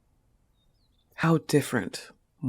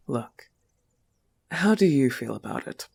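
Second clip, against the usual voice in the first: usual speaking voice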